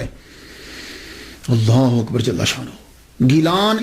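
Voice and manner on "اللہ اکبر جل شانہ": awed praise. Acted. Preacher's awed exclamation.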